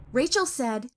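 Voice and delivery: usual speaking voice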